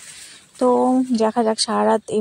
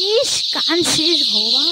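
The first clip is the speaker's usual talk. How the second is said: higher, louder, drawn-out groan of complaint; real exasperation over the noise; exasperated outcry; 5 semitones higher, 7 dB louder, slower than usual. Real, not acted. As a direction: cry out slowly, fed up with the din. Exasperated by screeching birds after power cut.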